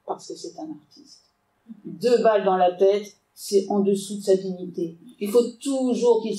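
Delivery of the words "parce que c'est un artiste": breathy secret whisper of the killer's line. Acted. Reading the killer Yuri's reply, whispered. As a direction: hush it like a confidence. Killer explains boss, read aloud.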